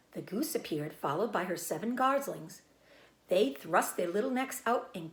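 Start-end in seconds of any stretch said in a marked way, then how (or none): none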